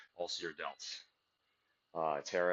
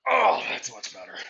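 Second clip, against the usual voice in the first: relieved; 8 semitones higher, 10 dB louder than usual. Real, not acted. Relief after adjusting form.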